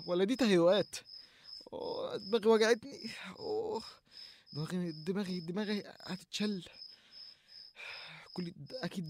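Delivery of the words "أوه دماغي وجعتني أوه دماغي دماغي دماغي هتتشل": pained. Acted. Head pain, groaning.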